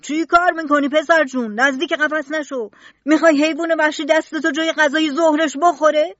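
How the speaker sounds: loud, high warning shout; keeper shouting. Acted. Zookeeper shouts at the boy near the panther cage, loud high warning. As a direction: yell at the boy to get back from the cage. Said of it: "مأمور فریاد زد".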